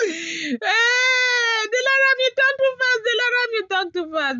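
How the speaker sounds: very high, loud shrieking complaint; hammed-up squealing viewer; mock viewer. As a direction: shriek it like an outraged fan. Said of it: "that is how I talk".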